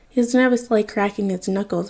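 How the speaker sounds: usual speaking voice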